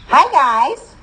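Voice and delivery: high, bubbly cheerleader greeting. A narrator's reading. Cheerleader greeting freshmen over the microphone, bubbly. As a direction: bubble it out brightly to the crowd. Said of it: "a girl bubbled into the microphone".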